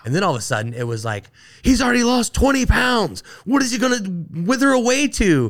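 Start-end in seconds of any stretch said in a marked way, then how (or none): 1.6-5.5 much higher voice selling the drama; news hype. Retold or imitated. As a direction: play up the breathless TV anchor. Media hyping his weight loss.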